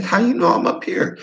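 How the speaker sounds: very high, airy question; startled awake by a knock; squeaky mock alarm. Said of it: "I'm like".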